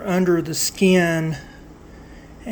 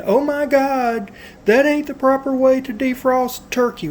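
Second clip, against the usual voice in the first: higher-pitched imitation of alarmed critics; mock freaking out; 8 semitones higher, 4 dB louder than usual. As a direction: mimic people fretting over turkey safety. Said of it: "all the people freaking out".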